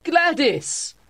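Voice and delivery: very high repeated call; high-pitched, insistent calling; crying out. A narrator's reading. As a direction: cry the name out high and urgently. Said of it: "I cried".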